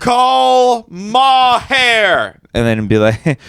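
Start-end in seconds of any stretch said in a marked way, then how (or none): 0.0-2.4 high, slow, drawn-out yell; yelling dad. Retold or imitated. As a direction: shout the command at the phone.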